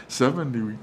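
normal talking voice

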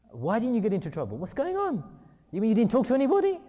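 loud, high-pitched indignant father; mock outrage. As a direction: scold him at full voice in hammed-up disbelief. Acted father mock-scolds untroublesome son.

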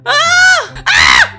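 two loud shrieks; panicked screams. Real, not acted. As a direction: scream while under attack. Being killed in game.

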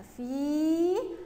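"فيل" sounded out slowly, long "ee"; spelling aloud while writing. Shown as a demonstration. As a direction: stretch "فيل" as if writing each letter. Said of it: "واشتهجها كده وأنا بكتبها". (تهجئة بطيئة ممدودة)

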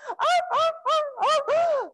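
high puppy yelps, imitated; puppy crying. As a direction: yelp like a crated puppy.

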